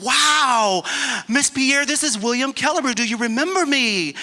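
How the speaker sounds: high, loud burst of excitement; excited greeting. Retold or imitated. Former patient reaches his old therapist by phone, excited. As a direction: exclaim it, voice soaring with thrilled recognition.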